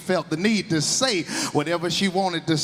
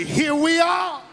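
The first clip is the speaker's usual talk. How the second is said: high, joyful shout; holler; 8 semitones higher, slower than usual. Retold or imitated. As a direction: call it out arriving in heaven. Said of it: "can't you hear her holler out".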